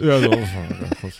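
low, slow, gruff mumble; Markus Krebs-style mumble. Retold or imitated. Cashier mumbling something, imitated in a low slow mumble. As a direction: lightly imitate a grumpy cashier. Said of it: "So Markus Krebs-mäßig".

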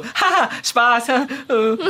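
high, slow fake laugh; mock awkward laugh-off. Heroine laughing off an insult, hammed-up awkwardness. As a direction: play a drawn-out, high forced joke.

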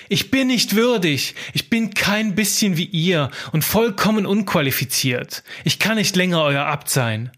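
desperate scribe. Acted. Scribe confesses to the monks at morning prayer, pleading outburst. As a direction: blurt it out as the scribe confessing unworthiness.

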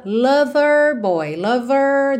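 American "lover" with the R curled; slow "lover boy" in American English; American accent. Shown as a demonstration. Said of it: "No inglês americano, eu cantaria". (sotaque americano, R enrolado em "lover")

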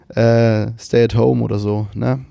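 usual speaking voice

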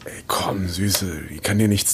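low, soft coaxing; soothing. Acted. Man soothing frightened partner, low and tender.

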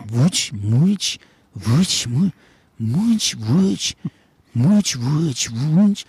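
"Wutsch, Mutsch" word by word, each mostly rising at the end; practising pronunciation. Retold or imitated. Slumped dreamer rehearsing the strange name to himself, tentative repeated "Wutsch, Mutsch". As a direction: try out "Wutsch" and "Mutsch" as separate, questioning attempts. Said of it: "übe immer diesen Namen sch-, zu sprechen".

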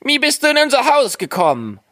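high, piping dwarf question. A narrator's reading. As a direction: pipe up very high, inquisitive. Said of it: "sprachen weiter die Zwerge".

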